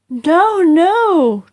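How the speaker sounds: high, loud eager no, no; excited protest. A narrator's reading. Jack correcting his mother, loud and high. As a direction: burst in to correct her. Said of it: "Jack cried".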